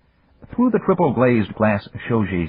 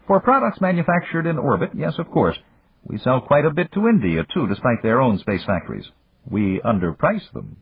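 put-on higher, measured voice of an old friend; Japanese magnate; 7 semitones higher than usual. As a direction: voice the older businessman, courteous and calm. Yamagata speaks.

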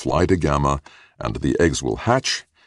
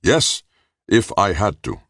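defiant answer; 7 semitones higher, 4 dB louder than usual. A narrator's reading. Ung answers a challenge.

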